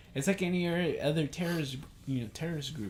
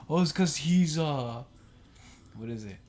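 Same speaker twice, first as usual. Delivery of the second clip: mocking supporter quote; 2 semitones higher than usual. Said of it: "He's like".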